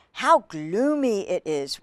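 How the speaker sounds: ordinary delivery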